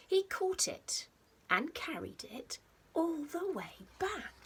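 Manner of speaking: lively storybook read-aloud with a hushed edge; reading to children. A narrator's reading. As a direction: read it like a bedtime picture book, playful and rhythmic. Reads picture book to children.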